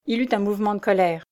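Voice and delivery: plain reading voice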